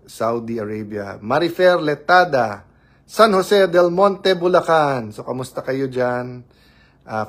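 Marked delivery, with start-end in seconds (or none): 1.2-5.1 high, loud and slow announcing of a viewer; slow loud name call. Real, not acted. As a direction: announce the viewer like calling them up. (mabagal at malakas na pagtawag sa pangalan)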